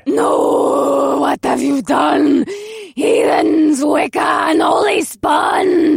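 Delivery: slow, loud outraged yelling; furious shout. Acted. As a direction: roar at the witches in fury. Hag enraged after attack.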